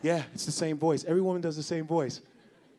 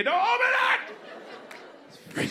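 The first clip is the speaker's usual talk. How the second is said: panicked shout; much faster than usual. Acted. Warning with a shout.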